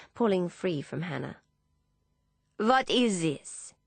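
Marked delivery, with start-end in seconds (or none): 2.6-3.7 put-on slow, higher voice in a heavy French accent. A narrator's reading. Philippe surprising the sisters, heavy French accent. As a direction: demand it with a thick French accent. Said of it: "he says in a heavy French accent".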